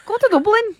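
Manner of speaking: laughing through a bad Irish accent; a playful, awful Irish accent; co-host laughs into a mock Irish accent. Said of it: "that is an awful accent".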